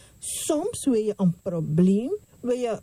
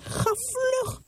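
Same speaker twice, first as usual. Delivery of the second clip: urgent prophet; 10 semitones higher than usual. Acted. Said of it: "Zij zei tegen zijn knecht".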